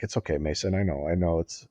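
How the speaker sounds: softly hushed reassurance, a touch mock; soothing host. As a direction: pat him down gently with the words. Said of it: "and he's like".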